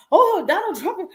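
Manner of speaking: high, loud mocking exclamation; mock outrage. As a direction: fling the name out like a scandalized critic.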